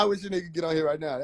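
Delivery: usual speaking voice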